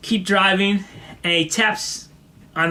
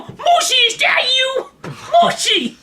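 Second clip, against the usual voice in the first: crying out; slower than usual. Retold or imitated. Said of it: "he's crying for Mushi".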